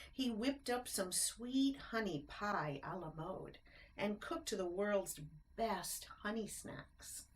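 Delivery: plain reading voice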